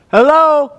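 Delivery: loud, high hello into the echoing tower; cooling tower echo. Shown as a demonstration. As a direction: shout hello to hear it bounce back. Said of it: "I don't know if you can hear my echo, but watch this".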